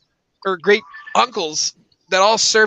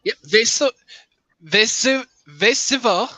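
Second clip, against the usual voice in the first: put-on German accent. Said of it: "my German accent is terrible".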